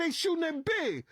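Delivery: very high, urgent report; retold alarm. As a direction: reenact breaking news of gunfire. Retold alarm during shooting.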